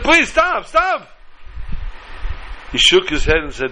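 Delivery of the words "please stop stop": high, pleading cry. A narrator's reading. Teacup pleading with the painter to stop. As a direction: beg to stop, voice pitched up.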